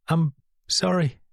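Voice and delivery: understated tearful sorry; starting to cry. A narrator's reading. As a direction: let the tears take the words. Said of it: "properly started to cry now".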